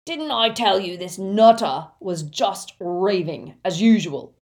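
loud, rough scolding. A narrator's reading. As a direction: rub it in loudly, rough and scornful. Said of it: "said a rough voice".

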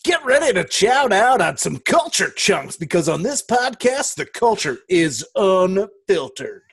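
loud, high, sassy hype voice. Shown as a demonstration. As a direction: sell it with big sass. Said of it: "so you want more sass then?".

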